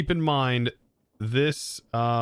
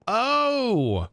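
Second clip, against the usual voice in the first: surprised; 11 semitones higher, 4 dB louder than usual. Real, not acted. Surprised by the lapis slot.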